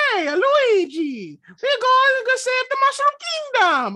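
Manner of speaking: very high, slow cartoon voice; hammed-up squeaky imitation, drawn out; Mario. Mocking a whole movie in Mario's voice, high slow imitation. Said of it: "if I had to hear him literally do it".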